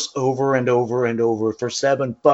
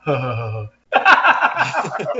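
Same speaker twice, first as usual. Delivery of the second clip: imitated laugh; 3 semitones lower, slower than usual. Co-host imitating the other's reaction, mock laughing. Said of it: "your reaction, you're all".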